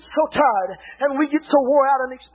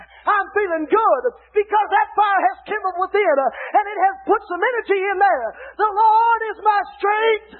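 preaching at full force; 4 semitones higher than usual. Acted. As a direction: drive it home to the pews. Preaching fire kindled within.

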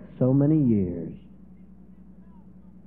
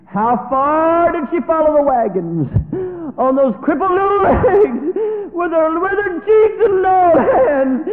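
high, loud, crying preaching voice; a preacher carried away by the story; impassioned preaching; 9 dB louder than usual. Acted. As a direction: preach it with tears in your voice. Preacher at full force.

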